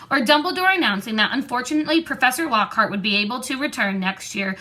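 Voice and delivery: ordinary delivery, nothing marked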